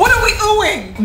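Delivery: high, disbelieving question; incredulous. Real, not acted. Mocking the cook's helper for oohing, high and incredulous. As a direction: throw the ooh back at them.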